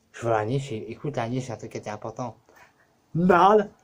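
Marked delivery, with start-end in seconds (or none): 3.1-3.7 high, loud shrieked cursing at a spider; hammed-up hysterical swearing; mock furious tirade. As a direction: scream insults in a squeaky put-on fury.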